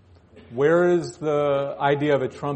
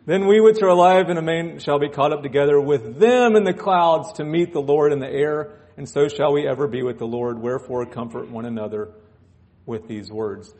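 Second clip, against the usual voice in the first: raised, ringing scripture proclamation; scripture reading; 6 dB louder than usual. Acted. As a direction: proclaim the verses to the church. Reads scripture aloud.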